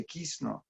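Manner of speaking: "Krishna" as Bengali "Kishno"; Bengali-style "Krishna", sounded "Kisno"; Bengali pronunciation. Shown as a demonstration. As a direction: say "Krishna" softly, no r, ending in o. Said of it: "The Bengalis say".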